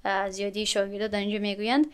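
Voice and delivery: ordinary talk, nothing marked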